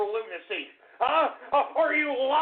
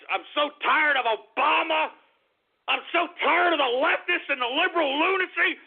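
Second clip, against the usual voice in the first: fed-up rant building to high screams; hysterical, anguished yelling; screaming outburst; 4 semitones higher than usual. Real, not acted. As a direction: scream it at the end of your rope.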